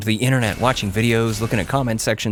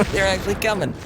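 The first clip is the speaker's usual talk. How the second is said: ordinary talk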